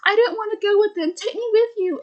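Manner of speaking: high, fast frightened begging; high scared little plea; pleading kid voice. Retold or imitated. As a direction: beg to be taken along, high and quick. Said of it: "he drops it and says".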